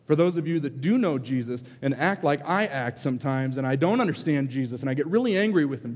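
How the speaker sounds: ordinary delivery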